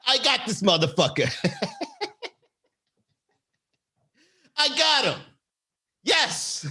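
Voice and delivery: high-pitched pretend triumph; imitated gleeful victory, laughing between phrases; mock gloating. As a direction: gloat like a schemer who won. Voices her gloating over her win.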